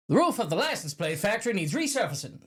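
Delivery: put-on high, old-fashioned announcer-like voice; put-on old-timey American delivery; old-timey warden. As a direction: say it in an old transatlantic accent. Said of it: "because of the way the warden says it".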